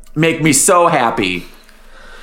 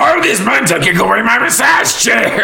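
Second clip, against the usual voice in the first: mock outrage; 7 semitones higher than usual. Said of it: "he's very mad about it".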